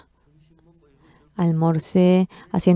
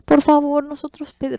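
urgent plea; 10 semitones higher than usual. Retold or imitated. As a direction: beg them urgently. Pleading to airline.

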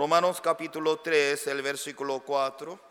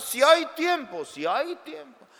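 insistent; 11 semitones higher, 3 dB louder than usual. Acted. Pastor insiste.

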